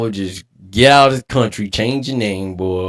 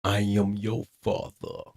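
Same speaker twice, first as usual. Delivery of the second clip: Darth Vader quote; 3 semitones lower, 9 dB quieter than usual. Retold or imitated. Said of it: "Darth Vader".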